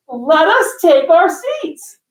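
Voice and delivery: put-on high, slow, drawn-out kid's squeal; imitating an ecstatic daughter's revelation; excited little kid. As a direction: stretch each word, building to a shriek. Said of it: "she's so freaking, like, she just won".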